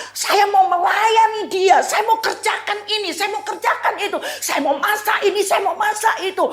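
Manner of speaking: high, hurried voice of fussy Martha; preacher voicing a frantic Martha, high and quick; busy Martha. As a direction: play Martha rushing about, breathless with chores. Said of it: "Kalau ditanya".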